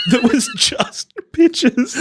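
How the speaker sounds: high, slow and giggly; words stretched through laughter; amused, high and drawn out; giggling. Real, not acted. Giggling about sister's folder.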